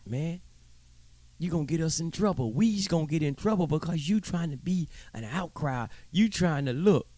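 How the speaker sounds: mocking the pacifiers who fear trouble. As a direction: scold the troublemaker like a frightened conformist. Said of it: "You wanna look, you pacifiers".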